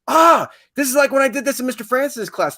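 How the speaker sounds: high, loud sudden recognition; excited student. Acted. Student recalling a past lesson.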